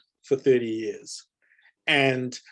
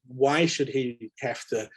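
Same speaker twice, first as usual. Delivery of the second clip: normal talking voice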